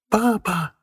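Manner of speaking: put-on high bright child's shout; ringing boy's voice. A narrator's reading. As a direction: call out like a little boy. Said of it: "звонкий мальчишеский голос".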